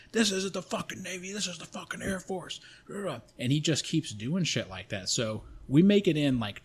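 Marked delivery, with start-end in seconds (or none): 0.1-2.6 high, mock-furious instructor rant; going off on him. Instructors correcting a confused recruit, high-pitched mock rant. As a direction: imitate instructors losing it, without full volume. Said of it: "they're going off on him, going crazy on him".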